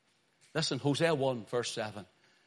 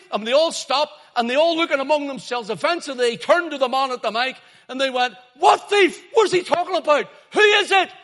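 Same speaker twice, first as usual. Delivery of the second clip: shouted, high-pitched open-air sermon; very loud, impassioned street-preacher proclamation; full-force preaching; 16 dB louder than usual. Real, not acted. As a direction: thunder it out over a packed street.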